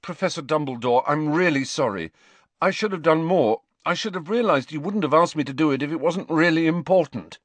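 high, loud, hurried apology; Harry, urgent apology. A narrator's reading. Pupil can stand the silence no longer, apologises urgently. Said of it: "When he could stand it no longer".

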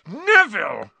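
high, loud outburst; exploding. A narrator's reading. Ron exploding at Neville, angry outburst. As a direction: explode on the name in exasperation. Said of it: "Ron exploded".

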